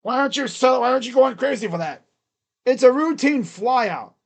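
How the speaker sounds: normal talking voice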